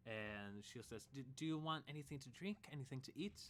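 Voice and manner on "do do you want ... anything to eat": hurried barmaid. Acted. Barmaid asks quickly.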